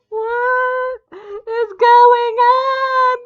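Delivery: high, loud, slow anguished cry; distressed wail. Real, not acted. Vlogger reacting to a ruined childhood, anguished wail. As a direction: wail in disbelief at the wrong spelling.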